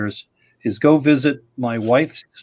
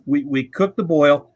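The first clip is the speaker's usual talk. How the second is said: normal talking voice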